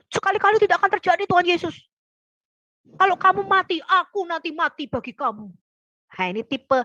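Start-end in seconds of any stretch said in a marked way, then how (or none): 0.1-5.5 fast, higher rash blurt; talking before thinking. Retold or imitated. Peter to Jesus, rashly promising to die, fast and high. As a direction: rush it out without thinking. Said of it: "ngomongnya lebih cepat daripada mikirnya".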